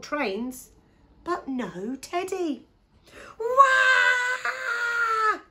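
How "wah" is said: very high, loud sustained scream; baby scream. Acted. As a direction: scream out one long baby cry. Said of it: "screamed Baby Pig".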